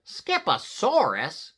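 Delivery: loud, high, drawn-out query; declaring mama. A narrator's reading. Mama comes into the room and questions him, declaring. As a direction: slowly throw the word back, loudly. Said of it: "declared Mama Junebug Jones".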